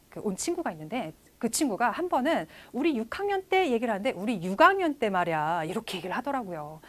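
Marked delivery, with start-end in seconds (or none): 4.1-5.6 Jeolla-style linking, softening "육 학년" to a g sound; Jeolla dialect. Retold or imitated. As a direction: imitate a Jeolla friend, "육 학년" linked as yu-gang-nyeon. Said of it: "사투리에서 조금 더 있는 것 같긴".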